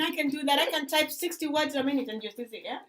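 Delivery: loud, high swaggering boast; cocky American bravado. Retold or imitated. Grace mocking the brash applicant, loud and high. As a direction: brag loudly like nothing's hard. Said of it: "Grace, who says".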